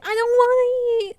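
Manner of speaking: put-on high-pitched toddler whine; mock crying. Three-year-old niece refusing soup, mock crying whine. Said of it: "like, crying".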